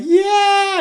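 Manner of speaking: loud, high drawn-out cheer; cheering crowd. Retold or imitated. Club crowd cheering every bumper ram, elated. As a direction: imitate a whole crowd roaring approval. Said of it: "they all emptied, and they were like".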